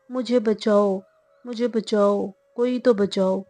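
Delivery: plain reading voice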